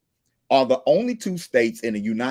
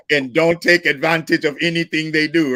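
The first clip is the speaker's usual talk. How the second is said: mock Russian-German accent, lightly put on; 3 semitones higher, 3 dB louder than usual. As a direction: lecture in a half-hearted Russian-German accent. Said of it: "is that accent, [name]?".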